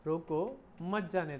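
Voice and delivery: "रोको", break, then "मत जाने" joined and falling; pause after रोको. Shown as a demonstration. Teacher repeating the stop-it reading for students, pause after "रोको". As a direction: separate "रोको", run "मत" into "जाने". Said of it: "words तो पूरे वही है".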